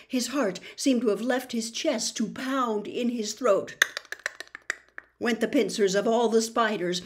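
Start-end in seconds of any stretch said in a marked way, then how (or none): none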